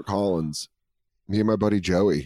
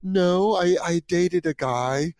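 flustered denial; 7 semitones higher, slower than usual. Retold or imitated. Caught fake, flustered denial.

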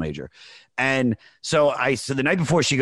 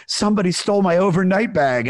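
high, loud, upset wail; panicked girlfriend; 9 semitones higher, 6 dB louder than usual. Retold or imitated. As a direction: squeal it out in distress. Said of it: "And she's like".

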